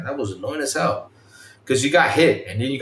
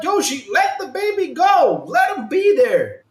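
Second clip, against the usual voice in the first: exasperated yell; 13 semitones higher, slower than usual. Retold or imitated. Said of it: "I'm like".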